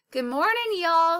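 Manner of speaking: put-on country accent. A narrator's reading. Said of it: "He had a strong country accent".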